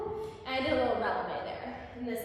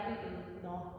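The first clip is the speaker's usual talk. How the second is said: rejecting lover; 5 semitones lower, 8 dB quieter than usual. Pretend. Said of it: "your honey buddy is like".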